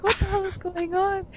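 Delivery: high-pitched retold bewilderment; baffled outcry. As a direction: imitate a startled, baffled group. Retelling the group's baffled reaction.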